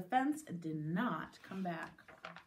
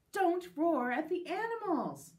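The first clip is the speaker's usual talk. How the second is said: louder, higher, emphatic telling-off; scolding teacher; 8 semitones higher, 6 dB louder than usual. Acted. Teacher to boy who roared, emphatic scolding. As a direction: scold with big swoops of pitch.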